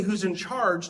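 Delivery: normal talking voice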